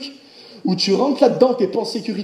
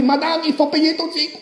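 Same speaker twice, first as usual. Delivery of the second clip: cashier's voice; 6 semitones higher than usual. Retold or imitated. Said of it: "elle a fait".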